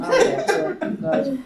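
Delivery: laughing through the words. Real, not acted. Retelling her cheeky reply to the neighbour, laughing. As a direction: giggle as you repeat it.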